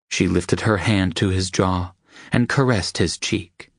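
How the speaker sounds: ordinary delivery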